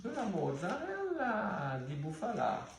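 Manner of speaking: mock Italian. Vlogger unpacking cheese, hamming up a mock Italian accent. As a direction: savour it like a hammy Italian chef, slowly.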